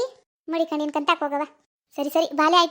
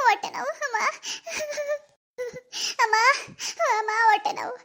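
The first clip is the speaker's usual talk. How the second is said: 1 crying in pain; 6 semitones higher than usual. Acted. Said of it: "ಅಳ್ಬೇಡ ಕಣವ, ಅಳ್ಬೇಡ".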